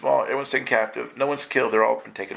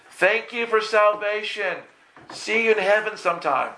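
breezy, higher, slowed brush-off; a flippant churchgoer; 9 semitones higher than usual. Retold or imitated. Preacher mimics churchgoers waving off God, breezy and high. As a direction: wave God off cheerfully.

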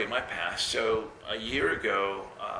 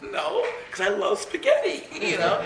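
high and loud through laughter; laughing squeal about spaghetti; 5 dB louder than usual. Retold or imitated. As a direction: giggle out the excuse. Retelling herself, laughing.

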